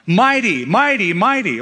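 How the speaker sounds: high, evenly repeated chant-like acclamation; mock angelic cry. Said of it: "The angels don't cry".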